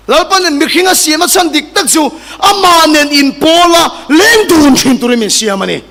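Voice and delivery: sermon voice rising to a shout; mounting, emphatic proclamation; preaching, building. Acted. Preacher building to full force.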